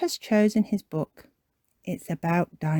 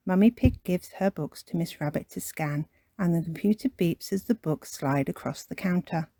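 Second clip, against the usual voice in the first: ordinary delivery, nothing marked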